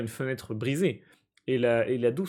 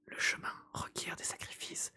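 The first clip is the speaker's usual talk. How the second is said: breathy unvoiced whisper; 15 dB quieter than usual. Techno Chamanatrix telling Gary a secret, whispered. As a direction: hiss it like a secret. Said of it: "le techno chamanatrix rugit".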